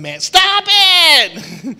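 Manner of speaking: high, loud, drawn-out whine of a teased person; squealing stop it; annoyed outburst. Retold or imitated. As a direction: cry out like someone fed up with teasing. Teased person snapping back.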